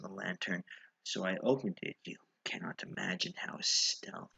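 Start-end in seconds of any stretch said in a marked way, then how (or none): 2.0-4.3 whisper. A narrator's reading. Narrator hushed describing stealth.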